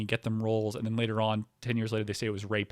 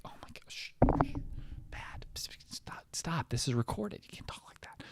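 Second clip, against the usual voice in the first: panicked hushed shushing; urgent whisper; 7 dB quieter than usual. Retold or imitated. Comic warning her the call is recorded, panicked whisper. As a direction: hiss it quietly, afraid of being overheard.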